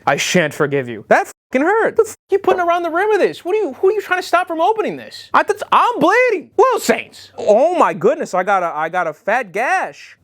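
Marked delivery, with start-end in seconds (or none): 1.1-10.2 much higher, fast, upset outburst; pained rant. Real, not acted. Bleeding finger, ranting at the brand in high-pitched shock.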